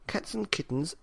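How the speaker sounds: ordinary delivery, nothing marked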